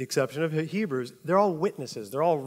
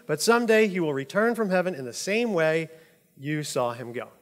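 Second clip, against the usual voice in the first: scripture reading; 3 semitones higher, 4 dB louder than usual. Acted. Reads scripture aloud.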